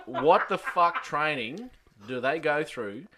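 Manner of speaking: normal talking voice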